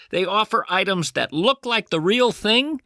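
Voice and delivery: ordinary delivery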